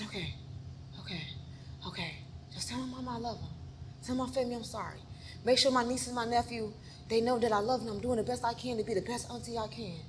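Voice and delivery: soft, fading farewell; faint last words. Retold or imitated. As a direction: speak weakly as if giving up. Retells last words while playing dead.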